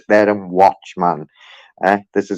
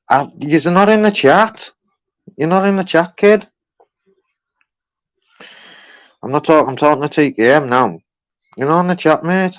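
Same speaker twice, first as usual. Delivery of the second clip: teasing high; 6 semitones higher than usual. Real, not acted. Teasing viewer missing from chat.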